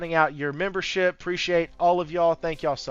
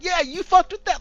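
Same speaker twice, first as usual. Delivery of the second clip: much higher, thrilled "Yeah, you FUCKED with that"; hyped agreement. Retold or imitated. Second friend answering the first friend, higher and more thrilled. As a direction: imitate a buddy cheering back, voice jumping way up. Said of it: "and then he was like".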